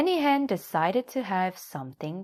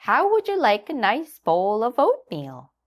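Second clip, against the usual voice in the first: high, louder hen voice; a bright, clucking hen; Nanny Hen; 6 semitones higher, 6 dB louder than usual. A narrator's reading. Hen greets the little donkey in a high voice.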